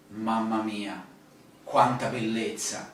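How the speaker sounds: quiet, reverent wonder; awed hush. Shown as a demonstration. Staring at the Mona Lisa.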